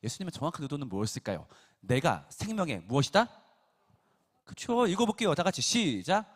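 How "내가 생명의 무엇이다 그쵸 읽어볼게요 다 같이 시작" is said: louder, higher prompt; rally them to answer together; preacher prompting the congregation, loud and lively; quizzing the crowd. Real, not acted.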